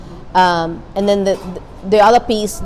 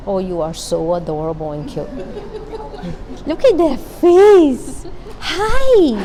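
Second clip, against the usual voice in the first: high, sing-song cooing, slow on the first lines; 7 semitones higher, slower than usual. Real, not acted. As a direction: gush over a cute little face. Cooing at a cute baby or pet.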